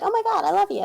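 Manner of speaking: high-pitched mock lovestruck confession; gushing girl. Mimics girl's gushing confession.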